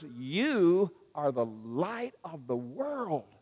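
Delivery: very slow, each word weighted; quoting Jesus slowly for the congregation to take in; slow solemn quote. Retold or imitated. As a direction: let every word land slowly. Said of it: "When Jesus says those words".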